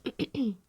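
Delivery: quick throat clear. Real, not acted. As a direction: clear the throat mid whisper. Clears throat during whisper.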